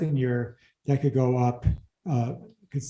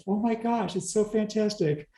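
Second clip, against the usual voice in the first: higher-pitched, sarcastic gushing; mock amazement; 6 semitones higher than usual. Host jokes about tiny net cash flow, mock amazement. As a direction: pretend to be thrilled by a pitiful figure.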